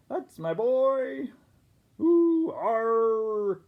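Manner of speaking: high, slow, hammed-up pirate cheer; proud pirate. Pirate father praising his son, high and hammed-up. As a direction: ham it up like a doting pirate dad.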